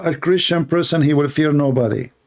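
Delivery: ordinary delivery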